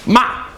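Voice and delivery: "Ma" starting high and dropping sharply; sharp falling tone. Shown as a demonstration. Teacher saying the word for scold, sharply falling tone. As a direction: drop "Ma" fast from a high start. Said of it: "goes down sharply".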